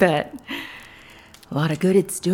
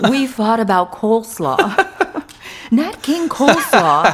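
cracking up while joking; louder, laughing through the silly punchline; giggly; 5 dB louder than usual. Acted. Woman admits a fight over coleslaw, cracking up.

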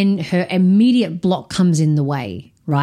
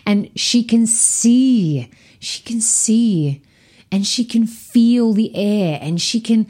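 higher, slow savouring delivery; acted wonder; 4 semitones higher, slower than usual. Inner child let out of the attic, savouring it in wonder. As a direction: draw each phrase out in mild wonder.

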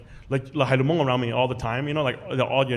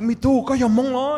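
high, loud shocked question; startled question; 10 semitones higher, 5 dB louder than usual. Retold or imitated. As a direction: ask it amazed. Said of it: "They're like".

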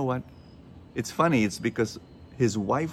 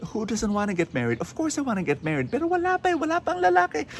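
high-pitched, exasperated protest, climbing as it goes; exasperated single woman; 14 semitones higher, faster than usual. Retold or imitated. Single woman answering her nagging aunties, exasperated and high-pitched. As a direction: plead your case in a much higher voice.

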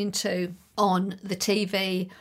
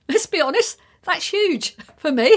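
laughing; 8 semitones higher, 8 dB louder than usual. Real, not acted. Laughing at her own big goal.

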